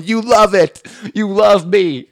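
high, loud taunt; punchy, hammed-up heel boasting; defiant talk-show villain. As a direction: goad a booing crowd. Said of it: "he stood up and he was going".

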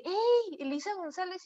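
high-pitched, delighted hello that drops off on the name; a stranger in the restaurant greeting a psychologist he admires, excited. Retold or imitated. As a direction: call out her name like a thrilled fan. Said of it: "llega alguien y me saluda y dice".

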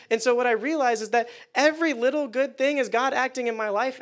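normal talking voice

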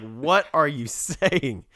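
slow, disbelieving, fed-up questions; exasperated. Retold or imitated. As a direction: ask like they've lost their mind. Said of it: "Socrates is almost a little bit exasperated too".

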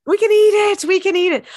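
high, loud celebratory echo; gleeful cheer. Real, not acted. Echoes co-host's cheer.

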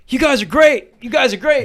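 put-on high, loud sloppy praise from a drunk; drunk guy impression. Homeless guy cheering the band, high and loud. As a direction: ham up a tipsy stranger cheering.

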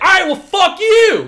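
high, loud comic outburst; hammed-up aggressive holler; mock belligerent yell. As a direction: yell it like picking a silly fight. Acting out a sudden belligerent outburst.

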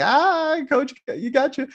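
very high, squealing teasing cheer; mock hooting. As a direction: hoot like rowdy teammates ribbing the coach. Boys mocking coach, cheering.

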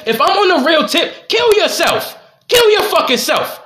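high, heated fury; furious outburst. Real, not acted. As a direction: let the anger boil over. Furious climax of rant.

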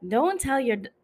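breaking off a southern accent try; southern accent attempt. Shown as a demonstration. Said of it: "I was trying to make a southern accent".

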